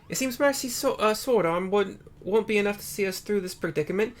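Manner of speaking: put-on lifted, earnest young scholar voice. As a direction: read her lines up high and eager. Character dialogue read aloud.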